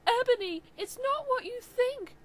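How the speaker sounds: loud, high anguished plea; sad scream. Acted. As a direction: cry out desperately. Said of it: "Draco screamed sadly".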